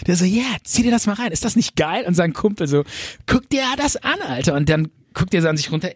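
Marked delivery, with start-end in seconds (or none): 0.2-2.0 high, gloating show-off; old friend showing off the game world; gleeful buddy. Retold or imitated. 3.3-4.5 high, gloating show-off; old friend showing off the game world; gleeful buddy. Retold or imitated. Buddy amazed.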